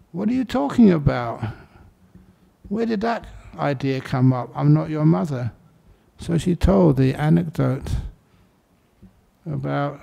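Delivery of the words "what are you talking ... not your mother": ordinary talk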